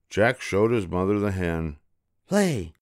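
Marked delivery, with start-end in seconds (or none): 2.3-2.7 put-on high, eager boy order; boy commanding. A narrator's reading. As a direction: tell the hen to lay. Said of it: "said Jack".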